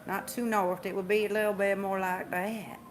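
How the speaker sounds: a light Southern twang; slight Southern drawl. Shown as a demonstration. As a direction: drawl the vowels a little. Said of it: "I sound like I got this".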